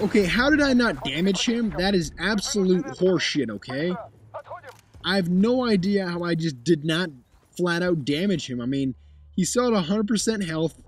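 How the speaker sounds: ordinary talk, nothing marked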